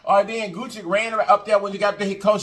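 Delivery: ordinary delivery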